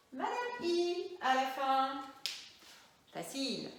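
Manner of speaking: usual speaking voice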